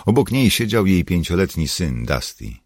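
plain reading voice

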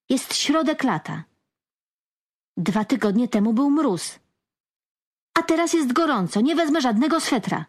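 put-on stubborn teenage protest, pushed high; defiant shout. A narrator's reading. Boy arguing with mother.

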